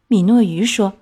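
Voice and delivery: plain reading voice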